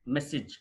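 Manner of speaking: మెసేజ్ with a short "sij"; correct pronunciation. Shown as a demonstration. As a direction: say మెసేజ్ as "MESS-ij". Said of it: "మెసేజ్ కాదు". (మెసేజ్ చివర "సిజ్" అని)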